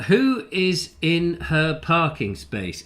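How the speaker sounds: slow, halting read-out; badly read question. Real, not acted. Host misreading a viewer's question, slow halting read. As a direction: plod through the question word by word. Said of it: "I read that very badly".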